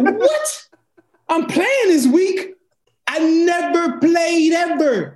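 high, slow, stunned disbelief; hammed-up shout of disbelief, drawn out; retold shock. Shocked at being told to play.

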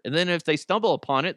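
normal talking voice

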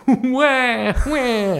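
high mock baby wail; imitated baby cry. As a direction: wail like a baby through a laugh.

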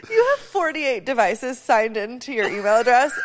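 laughing through words. Retold or imitated. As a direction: giggle through the line. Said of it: "she was like".

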